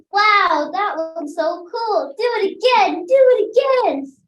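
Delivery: bubbling over with delight; excited. Acted. Girl begs dragon excitedly.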